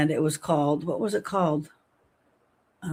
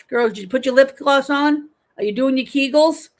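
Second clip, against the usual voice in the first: calling out; 6 semitones higher, 6 dB louder than usual. Acted. Calling the viewers to exercise.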